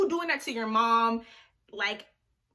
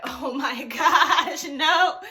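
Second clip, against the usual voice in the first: slow, louder words broken by laughter; laughing embarrassed; 7 dB louder, much slower than usual. Real, not acted. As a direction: laugh through the denial. Embarrassed reaction to being teased.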